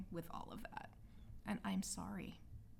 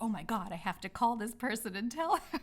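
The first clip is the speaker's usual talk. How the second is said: louder, laughing through the words; bubbling over, half laughing; excited; 3 semitones higher, 5 dB louder than usual. Real, not acted. Recalling her rush to call a friend, excited and laughing.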